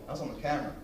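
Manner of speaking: ordinary talk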